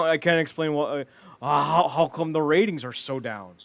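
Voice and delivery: normal talking voice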